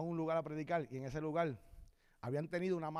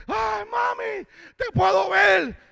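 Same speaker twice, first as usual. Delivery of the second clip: high, loud cry to his mother; imitated overjoyed outcry; amazed boy crying out; 13 semitones higher, 14 dB louder, much slower than usual. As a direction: shout out in acted amazement. Voicing boy suddenly seeing.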